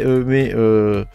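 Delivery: usual speaking voice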